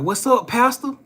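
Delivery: high, bright mock greeting. Imitating the old man's greeting to the young man. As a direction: call out a cheerful hello to the pastor.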